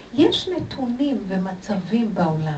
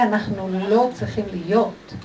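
ordinary talk, nothing marked

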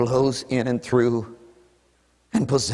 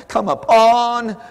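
louder and higher, drawn out slowly; loud cry; 8 semitones higher, 4 dB louder, much slower than usual. Real, not acted. Rising cry in prayer.

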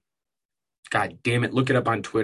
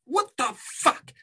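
much higher, slow, disbelieving outrage; a shrill real what-the-fuck of outrage; high exasperated squeal; 13 semitones higher, slower than usual. Real, not acted. Outraged rant about cancelled cookies.

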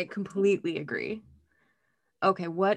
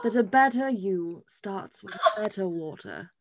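lightly done fancy British read; a hint of upper-class British; posh British accent; 3 dB louder than usual. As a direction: read the bottle like a rich Brit. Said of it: "I like to do British".

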